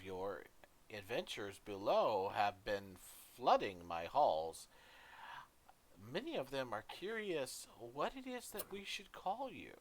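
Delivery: a gracious dwarf queen, warm welcome. Acted. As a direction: greet the guests broadly. GM voices the queen.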